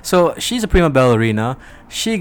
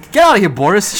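mock scolding; 6 semitones higher, 9 dB louder than usual. Scolding himself out of the bit.

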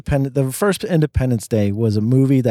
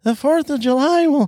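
much higher, whiny mock speech. Shown as a demonstration. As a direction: whine the president's speech. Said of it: "in a high-pitched, like, whiny voice".

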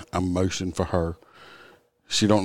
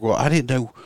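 higher put-on whiny voice of the driver; mock driver excuse; 6 semitones higher, 4 dB louder than usual. Officer imitating the driver's excuse, higher mocking voice. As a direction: play her excuse back in a silly higher voice. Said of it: "she's like".

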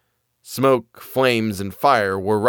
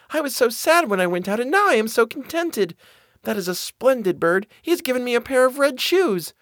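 put-on high, delighted girl's voice; happy girl; 13 semitones higher than usual. A narrator's reading. Marlene back home with new red shoes, high happy voice. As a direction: speak up high, thrilled with the gift. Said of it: "she was contented and happy".

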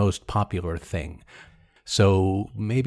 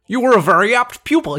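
high, loud Jimmy Stewart attempt; Jimmy Stewart impression; 11 semitones higher, 6 dB louder than usual. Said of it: "I think you're better than mine".

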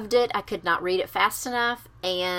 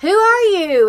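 loud hey; 10 semitones higher, 9 dB louder, slower than usual. Retold or imitated. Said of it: "And he goes".

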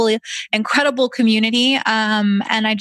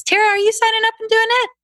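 much higher, thrilled question; eager question; 13 semitones higher, faster than usual. Real, not acted. Host excitedly asks guest if she is signing up, high and thrilled. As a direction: bounce it up with delight.

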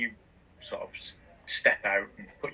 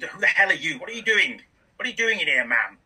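high, loud indignant demand; angry sheriff; 6 semitones higher, 4 dB louder than usual. Acted. Sheriff confronting the thieves in his library, indignant and loud. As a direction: bark at the intruders.

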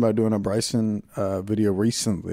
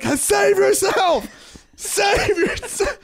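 very high, drawn-out comic wail; mock desperate cry; much slower than usual. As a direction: stretch it out like a melodramatic warning. Acted cry down a held-away phone.

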